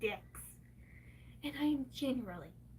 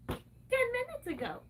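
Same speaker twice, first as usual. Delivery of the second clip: ordinary talk, nothing marked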